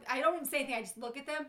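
ordinary delivery

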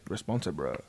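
low, quiet mocking bro voice; mock deep voice. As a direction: drop it low and mock him. Teasing mock deep echo.

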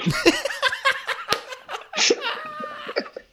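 real burst of high laughter. Real, not acted. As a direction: laugh high and hard at yourself.

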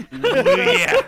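loud, high wrestler catchphrase; pro wrestler growl. Acted. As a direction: bellow it like a hyped wrestler. Wrestler shades on, cream of the crop.

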